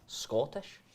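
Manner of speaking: tentative Scottish lilt; Scottish accent. Shown as a demonstration. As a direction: try the word out in a Scottish accent. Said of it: "I'll give it a go in Scottish".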